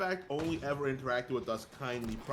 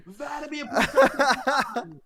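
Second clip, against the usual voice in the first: mock posh voice; 13 semitones higher, 11 dB louder, slower than usual. Mimicking the posh voice laughing.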